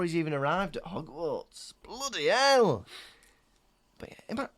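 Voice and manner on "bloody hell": Ron impression.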